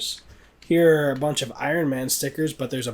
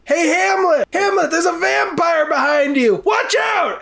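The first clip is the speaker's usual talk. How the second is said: much higher, louder shout; mock yelling heckler; 5 dB louder than usual. Lincoln heckling the play, shouting at Hamlet. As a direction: yell a warning across the theatre. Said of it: "he starts going".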